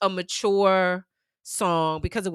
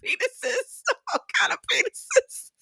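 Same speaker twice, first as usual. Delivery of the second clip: mock shocked excitement. Excitedly describing finding phone album.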